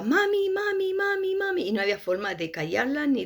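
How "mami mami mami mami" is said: put-on high child's voice crying for mom; much higher, small girl's panicked call; screaming little girl. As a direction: imitate a frightened child calling "mami". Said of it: "la niña gritaba".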